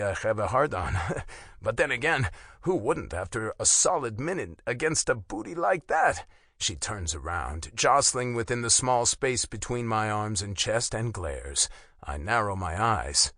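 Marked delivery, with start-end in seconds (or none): none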